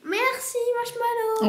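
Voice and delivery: high sweet thank-you; cute toy voice. Pretend. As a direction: speak like a little plush.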